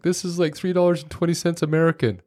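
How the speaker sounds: high-pitched retold crying kid; lightly acted upset little boy; imitating upset child. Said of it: "he starts crying".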